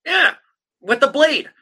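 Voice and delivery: high, loud burst; excited. Real, not acted. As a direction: jump up with excitement. Excited explaining the cut.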